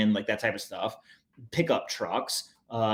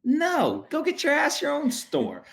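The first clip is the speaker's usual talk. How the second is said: mock frontier retort; 13 semitones higher, slower than usual. Mock reply in the prairie skit.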